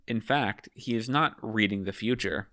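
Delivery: ordinary talk, nothing marked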